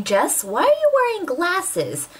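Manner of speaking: high, slow questioning voice; curious viewer. Acted. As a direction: put on a wondering viewer's high-pitched question. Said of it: "you're probably wondering".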